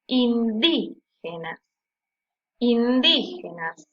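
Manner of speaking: "Indígenas" said slowly, leaning on a stretched "dí"; stress on DÍ. Shown as a demonstration. Teacher helping students hear the strongest syllable, slow stress on "dí". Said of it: "que suena con mayor fuerza".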